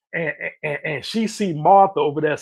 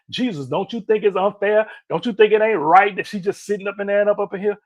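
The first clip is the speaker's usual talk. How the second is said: higher, louder complaining whine; annoyed attitude; 4 semitones higher, 4 dB louder than usual. Retold or imitated. Martha complaining to Jesus about her sister, whiny and indignant. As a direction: complain like she's been wronged. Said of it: "that attitude in her voice".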